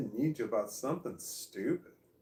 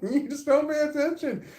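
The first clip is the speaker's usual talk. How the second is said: put-on higher, louder teasing teenage girl, laughing; teen friend teasing; 11 semitones higher, 10 dB louder than usual. Daughter's friend teasing the parent for not listening, higher and laughing. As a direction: tease the oblivious parent with a giggle. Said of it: "And she's like".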